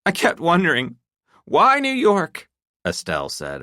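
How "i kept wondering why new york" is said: high, trembling question held back; a wife asking with a shaking voice. A narrator's reading. Wife confronting her husband, voice shaking. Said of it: "her voice shaking".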